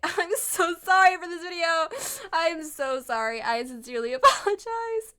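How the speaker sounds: sheepish laughing sorry; laughing apology. Real, not acted. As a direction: giggle while saying sorry. Laughing apology at video end.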